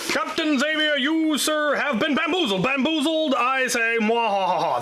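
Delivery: high, hammy villain gloat; sneering panto villain. Pretend. Reading out gift note as villain.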